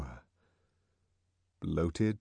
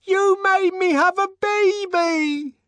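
high, loud, slow scream; 9 dB louder, slower than usual. Acted. Linda screaming at the director above the uproar. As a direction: scream it over the crowd. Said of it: "she screamed above the uproar".

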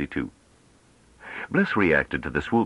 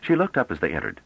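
usual speaking voice

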